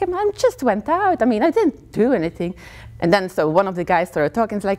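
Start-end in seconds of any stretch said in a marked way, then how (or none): 0.0-2.5 high-pitched, innocent protest; defensive protest. Retold or imitated. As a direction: plead innocence in a raised voice. She defends herself to gatekeeper.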